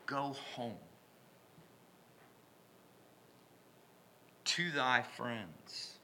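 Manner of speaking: soft, slow gentle command; tender sending off. Acted. Jesus gently sending the man home, soft and slow. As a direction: send him home gently. Jesus voiced softly, slowly.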